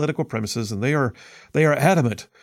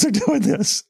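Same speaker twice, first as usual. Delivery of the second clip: laughing disbelief; 11 semitones higher, slower than usual. Real, not acted.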